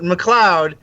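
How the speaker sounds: usual speaking voice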